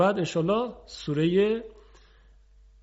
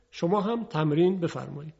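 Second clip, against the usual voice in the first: ordinary delivery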